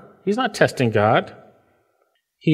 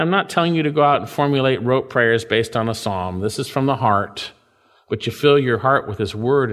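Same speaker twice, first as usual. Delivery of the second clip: normal talking voice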